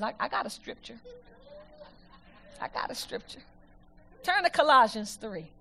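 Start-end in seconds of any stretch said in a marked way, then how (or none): 0.0-3.4 hushed aside. Real, not acted. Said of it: "hushed aside".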